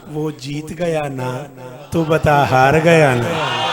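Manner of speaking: "जीत" and "हार" drawn out slowly, low, each phrase falling on "ना"; challenging tag questions. Shown as a demonstration. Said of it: "लहजा बदल गया".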